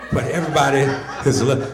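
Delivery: normal talking voice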